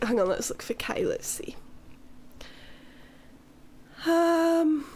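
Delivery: usual speaking voice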